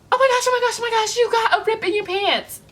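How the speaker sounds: high, loud imitation of a frantic girl; urgent alarm. Stranger alerting her to ripped pants, frantic mock alarm. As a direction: blurt it out in a high, put-on panic.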